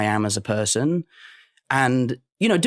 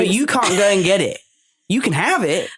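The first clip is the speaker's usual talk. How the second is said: lightly voiced dad, a bit higher; correcting dad; 4 semitones higher than usual. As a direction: correct your son's wording. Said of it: "my dad always says to me".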